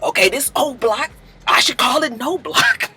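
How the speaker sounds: hushed mock bravado; imitated whisper. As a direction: keep it soft and sneaky.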